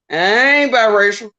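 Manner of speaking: ordinary delivery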